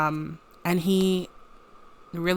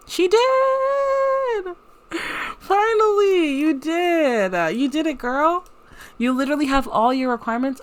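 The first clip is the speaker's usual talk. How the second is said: high, loud squeal of joy; thrilled cheer; 10 semitones higher, 4 dB louder, slower than usual. Real, not acted. Player cheering her sim's new skill point, thrilled squeal. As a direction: celebrate like your friend just won.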